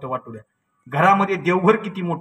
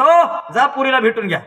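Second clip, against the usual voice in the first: high, loud urging; calling out; 9 semitones higher, 5 dB louder than usual. Retold or imitated. A guest urging father to meet his daughter, called out high. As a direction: call him over insistently.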